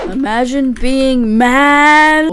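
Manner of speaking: slow, loud gamer taunt, swelling on the last word; mocking taunt. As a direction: stretch it out to needle them. Taunting an opponent in game.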